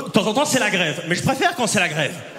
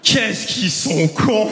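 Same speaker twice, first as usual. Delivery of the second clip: slow deadpan punchline; much slower than usual. Acted. Punchline drawn out slowly.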